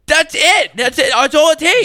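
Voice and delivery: high, loud mock celebration; excited shout. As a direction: shout it out to a crowd, pitch way up. Acted excited reaction to the heckler.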